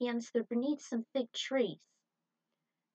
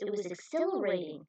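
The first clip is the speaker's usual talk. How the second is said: usual speaking voice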